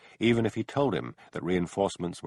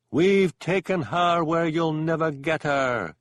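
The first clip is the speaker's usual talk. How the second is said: high, loud, slow gloating ghost; cackling unearthly voice; 9 semitones higher, 6 dB louder, slower than usual. A narrator's reading. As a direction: gloat like a triumphant spirit. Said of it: "cackled the unearthly voice".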